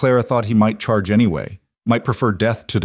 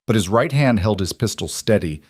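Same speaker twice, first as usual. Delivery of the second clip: ordinary delivery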